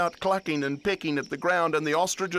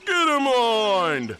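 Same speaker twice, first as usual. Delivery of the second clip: high, loud, stretched call; falling drawn-out shout; yell; 8 semitones higher, 6 dB louder, slower than usual. A narrator's reading. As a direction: holler it long and high. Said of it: "does he actually say".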